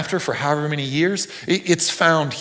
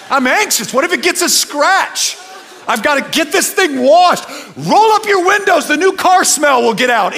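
mock anxious owner; 12 semitones higher, 7 dB louder than usual. Voicing fussy new car owner.

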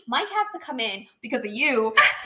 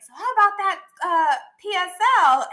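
imitating a sly kid; 8 semitones higher, 6 dB louder than usual. Friend mimics Michael hinting for a latte, high teasing kid voice. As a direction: mimic a boy fishing for a treat. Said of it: "Michael was like".

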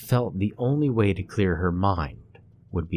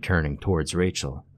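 ordinary delivery, nothing marked

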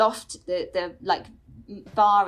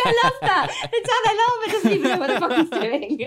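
high, loud laughing delight; 10 semitones higher, 5 dB louder than usual. Retold or imitated. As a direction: squeal it through laughter. Laughing delight at Dalai Lama.

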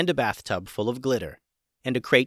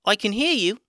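guinea pig voice; 10 semitones higher, 4 dB louder than usual. A narrator's reading. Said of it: "said the guinea pig".

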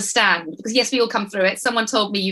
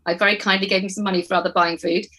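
ordinary delivery

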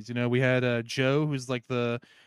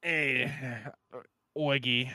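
put-on New Yorker accent; 3 semitones lower, 5 dB quieter, slower than usual. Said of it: "salty ass New Yorker voice".

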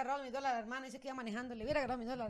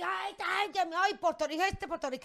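higher, lightly squawked mock complaining; arguing parrot imitation; 5 semitones higher, slower than usual. Owner imitating her arguing Puerto Rican bird, light squawk. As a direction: imitate a bird that argues back.

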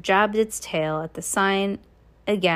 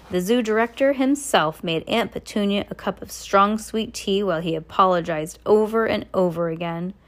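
plain reading voice

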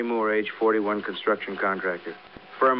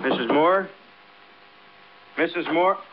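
calling through a door, higher and louder; 9 semitones higher, 4 dB louder than usual. Acted. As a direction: call her from the next room. Calling into the bedroom.

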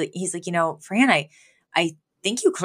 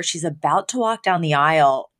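ordinary talk, nothing marked

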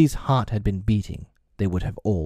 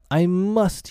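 shaking voice; 10 semitones higher than usual. A narrator's reading. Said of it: "he answered, shaking".

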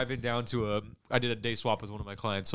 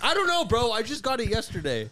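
mock defensive whine; 11 semitones higher than usual. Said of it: "I'm like".

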